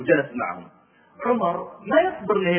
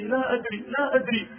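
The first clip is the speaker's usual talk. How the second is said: crying; 6 semitones higher, 3 dB louder than usual. Retold or imitated. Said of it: "قالت وهي تبكي".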